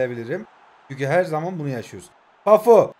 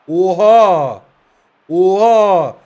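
shocked oha; 9 dB louder, much slower than usual. Real, not acted. Shocked at a wild name guess.

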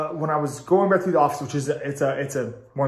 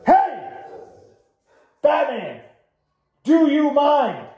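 sudden much higher yell across the room; calling out; 13 semitones higher, 5 dB louder, much slower than usual. Real, not acted. Vlogger yelling at his dog Batman to stop, a high loud call.

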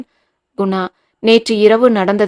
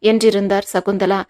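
plain reading voice

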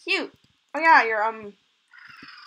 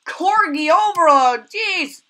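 excited exclaim; 5 semitones higher, 7 dB louder, much slower than usual. Real, not acted. Too many corgis hatch.

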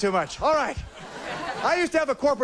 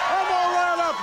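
hyped-up entrance; 7 semitones higher than usual. Acted. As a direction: burst on stage all riled up. Hyped comic persona greeting crowd.

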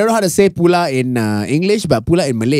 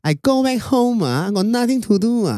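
put-on Chinese accent; 6 semitones higher than usual. Co-host tells Spotify listeners about his day, mock Chinese accent. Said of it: "Why do I sound like I'm from China?".

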